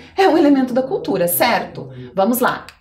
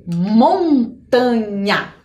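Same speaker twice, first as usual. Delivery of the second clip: "Montanha" drawn out very slowly; teacher slowly revealing "Montanha"; much slower than usual. Shown as a demonstration. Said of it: "Muito bem".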